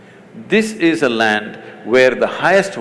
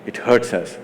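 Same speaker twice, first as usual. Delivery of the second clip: softer, lower imitation of hurt followers; mock hurt complaint; 6 semitones lower, 6 dB quieter than usual. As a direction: voice a mild, wounded plea in a hushed tone. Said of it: "people get very hurt".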